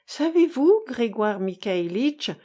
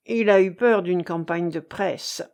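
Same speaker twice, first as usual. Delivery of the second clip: usual speaking voice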